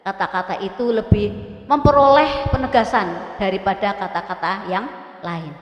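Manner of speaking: ordinary talk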